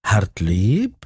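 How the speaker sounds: disguised voice. A narrator's reading. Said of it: "وقد غيرت صوتها".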